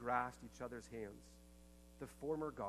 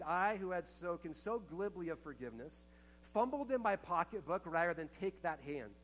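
higher and louder, impassioned sermon voice; preaching at full force; 5 semitones higher, 8 dB louder than usual. Acted. Preacher retelling a survivor's memory to the congregation, impassioned and loud. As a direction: preach her words out to the whole room.

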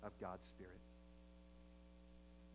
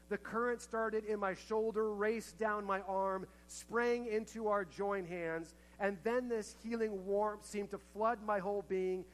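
much higher and louder, slow and soaring; preaching climax; 9 semitones higher, 11 dB louder than usual. Acted. Preacher reaching the healing moment of the story, soaring and slow. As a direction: let the climax of the story soar, slowly.

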